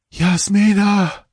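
high, drawn-out wail from far away; far-off ghostly cry. Acted. Dying king calls his sister from afar, high ghostly wail. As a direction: call her name as if from another realm. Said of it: "that far, weirdly dreeing cry".

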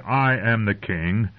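slow, even, unexcited quoting; measured quotation. Acted. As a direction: weigh each word with calm authority. Grandfather quotes the boast measuredly.

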